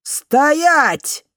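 high, loud furious bark over a loudspeaker; through a megaphone. A narrator's reading. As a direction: roar it through a bullhorn dripping with malice. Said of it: "Голос в мегафоне опять взревел".

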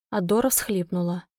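ordinary delivery, nothing marked

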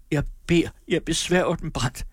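pleading Sofie. A narrator's reading. Sofie begs Brandt to stop, high-pitched pleading. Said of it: "sagde den dødblege Sofie".

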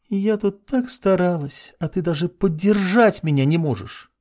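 offended tone. Acted. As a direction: sulk like a wronged girl. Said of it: "говорить с очень обиженным тоном".

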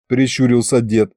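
usual speaking voice

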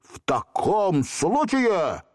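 высокий, медленный грозный крик; пугать джинна страшным голосом; scariest voice. A narrator's reading. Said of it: "вскричал Волька самым страшным голосом". (самым страшным голосом)

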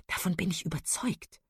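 soft, half-whispered agreement; hushed reply. A narrator's reading. Marian agrees with Willoughby's secret suspicion, hushed. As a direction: keep it under your breath, confidential.

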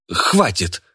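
sudden, emphatic cry of protest; exclamation. A narrator's reading. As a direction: cut in with a sharp exclamation. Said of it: "воскликнул он". (резкий возглас)

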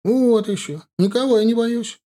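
put-on high-pitched boyish protest; huffy grumble. A narrator's reading. Boy denying to a teasing soldier he is afraid, huffy. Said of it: "нахмурившись, буркнул тот".

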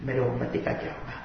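low, soft tearful plea; hushed plea. Acted. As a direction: draw them in with a hushed prayer. (دھیمی آواز میں التجا)

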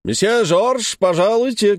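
calling out. A narrator's reading. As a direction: shout the name through the door. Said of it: "крикнул кто-то за дверью".

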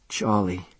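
breathless, hushed gasp. A narrator's reading. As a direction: gasp the name as if waking from a dream. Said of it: "she gasped".

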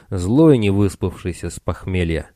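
plain reading voice

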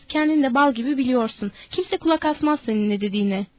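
plain reading voice

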